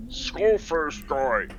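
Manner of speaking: mouth-full speech. Acted. Barbarian stuffing his face with pig, slow mouth-full speech. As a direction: talk through a mouthful of pork. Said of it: "speech is impaired as he's currently stuffing his face with pig".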